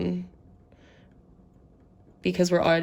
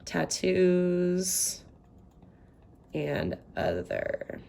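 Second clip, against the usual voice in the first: ordinary talk, nothing marked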